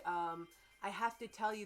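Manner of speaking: normal talking voice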